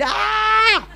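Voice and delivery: high, loud shrieking scream; kids screaming. Retold or imitated. Kids seeing chicken feet, high loud screaming. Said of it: "the kids start screaming".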